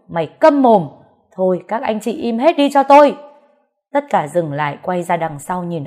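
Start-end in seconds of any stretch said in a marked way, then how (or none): 0.1-3.2 loud, raised-pitch command for silence; angry shout. A narrator's reading. Elder silences the quarrelling family, angry shout. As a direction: shout everyone down, building to the end.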